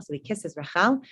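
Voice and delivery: ordinary talk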